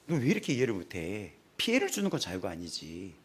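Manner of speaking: normal talking voice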